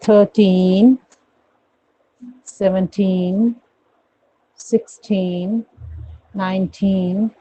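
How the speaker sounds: "Thirteen, seventeen, sixteen, nineteen" with stretched rising "टीन"; slow spaced drill, weight on "टीन"; long stressed TEEN. Shown as a demonstration. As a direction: hold each final "टीन". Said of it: "long pronounce करोगे".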